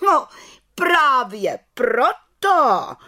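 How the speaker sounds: high, loud, very slow rebuke; scolding. A narrator's reading. Head witch reproves the little witch, scolding. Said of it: "hubovala vrchní čarodějnice".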